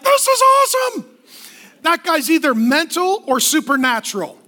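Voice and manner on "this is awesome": high-pitched, put-on cry of delight; mock elation. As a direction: exclaim like an absurdly thrilled prisoner. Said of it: "You're like".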